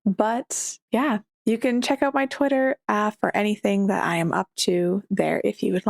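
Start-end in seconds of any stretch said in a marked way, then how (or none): none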